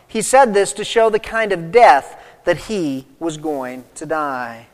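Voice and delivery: ordinary talk, nothing marked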